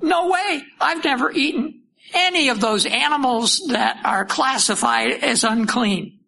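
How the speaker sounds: high, startled protest; horrified. Acted. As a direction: recoil in horror as you refuse.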